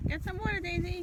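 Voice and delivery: high coaxing to a pet; talking to a dog. Real, not acted. Telling dog to drink.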